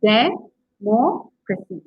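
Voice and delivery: de... MOC... racy, very slow and broken up; democracy spelled out slowly by sound, weight on MOC; stress on third-last syllable. Shown as a demonstration. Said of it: "Third from last".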